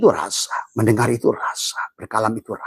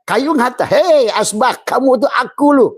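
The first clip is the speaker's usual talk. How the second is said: playful wood voice; 6 dB louder than usual. Acted. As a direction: play the wood calling out, hammed-up and high. Wood voiced addressing the ashtray.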